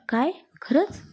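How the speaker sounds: mild delighted cry, pitch leaping up on the last word; thrilled disbelief without really shouting; delighted exclamation. A narrator's reading. Anju hears the shopping news from her fiancé, mildly delighted exclamation. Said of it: "तीही आनंदाने ओरडली".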